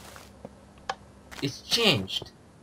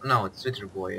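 ordinary delivery

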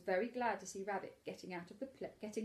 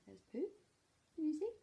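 ordinary delivery

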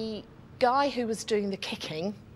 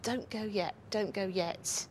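softly pleading under the breath to wait; a hushed plea meant only for him; saying quietly; 6 dB quieter than usual. Retold or imitated. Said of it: "saying quietly to him".

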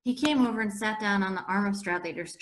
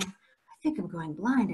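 hoarse voice; slower than usual. Acted. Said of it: "I said in this very hoarse voice".

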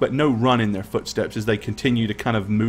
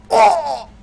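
put-on kid crying out; 4 dB louder than usual. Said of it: "hear one of the kids go".

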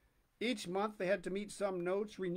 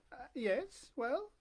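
high, wary prompting; lightly voiced friend; puzzled lady; 5 semitones higher, slower than usual. Forestier voiced.

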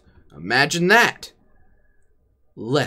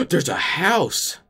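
gasping surprise; 7 semitones higher, slower than usual. Acted. Gasps spotting a house.

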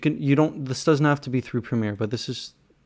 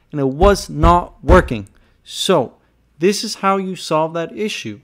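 loud, raised-pitch exasperation; a little heated; 6 semitones higher, 8 dB louder than usual. Real, not acted. Frustrated creator telling viewers how to solve the issue, a little heated. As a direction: vent it loudly, stressing the words. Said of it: "Sorry if I'm getting a little heated".

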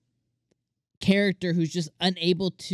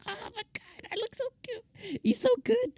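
put-on gushing girl; 5 dB quieter than usual. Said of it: "And she's like".

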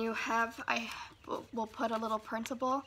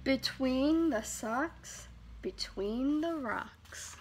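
slow recital; 2 semitones higher, much slower than usual. Shown as a demonstration.

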